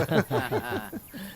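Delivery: high, light laughter; amused laugh. Acted. Friend laughs at the joke about greenery, amused.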